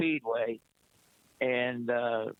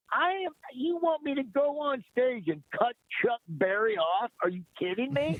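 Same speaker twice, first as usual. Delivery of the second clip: much higher, disbelieving protest; incredulous; 10 semitones higher than usual. Retold or imitated. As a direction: retell the shock of being asked to cut off a legend. Retold disbelief at being told.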